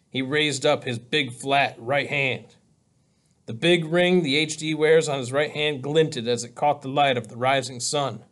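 ordinary delivery, nothing marked

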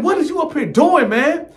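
elevated, exasperated cellmate. Retold or imitated. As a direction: climb into a high, outraged register. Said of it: "He done took me to my higher voice".

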